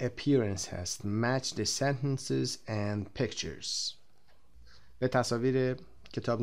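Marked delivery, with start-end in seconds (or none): 1.0-3.9 slow reading. Shown as a demonstration. Teacher reads instruction very slowly.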